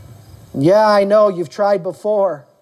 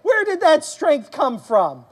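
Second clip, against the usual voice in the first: higher-pitched, drawn-out amazed question; overwhelmed; 7 semitones higher, slower than usual. Acted. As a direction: act out wide-open wonder. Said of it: "overwhelmed with his strength".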